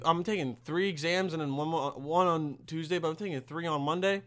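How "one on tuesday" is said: ordinary delivery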